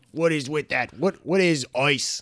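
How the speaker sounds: baffled mock Aussie; mock Australian accent. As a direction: stretch the vowels into broad Australian. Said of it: "they pronounce all their I's like an oi".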